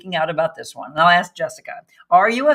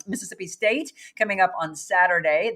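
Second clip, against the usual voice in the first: normal talking voice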